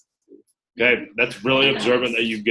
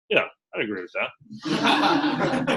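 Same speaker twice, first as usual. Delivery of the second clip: very slow, restrained agreement; holding it back; 3 semitones lower, 4 dB quieter, much slower than usual. Shown as a demonstration. Recruiter acting cool after an amazing answer, holding it back. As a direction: agree slowly, hiding how impressed you are. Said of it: "holding it back 'cause you're".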